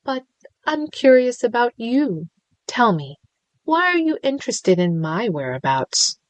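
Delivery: lilting villain, high on I'm curious; playful, menacing lilt; sing-song villain. A narrator's reading. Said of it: "the sing-song voice".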